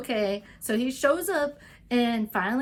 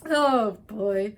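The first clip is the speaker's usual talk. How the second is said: louder, groaning dread; dismayed; 5 dB louder, much slower than usual. Retold or imitated. Alice hearing the bad rewrite news, dismayed oh no. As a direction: let your heart sink audibly. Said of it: "You're like".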